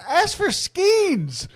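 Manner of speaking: absurd boss demand. Retold or imitated. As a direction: stretch it out like a ridiculous demand. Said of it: "He said".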